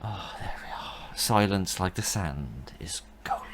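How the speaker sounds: soft slow whisper. Real, not acted. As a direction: breathe the words out quietly. Quiet whispered aside during game.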